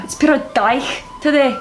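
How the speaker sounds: Scottish dialect phrase; Scottish accent. Shown as a demonstration. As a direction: grumble about weather in Scottish. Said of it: "On to Scottish".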